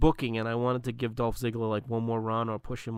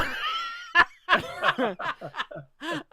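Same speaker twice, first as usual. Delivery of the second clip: big laugh. Real, not acted. Cracking up at the name.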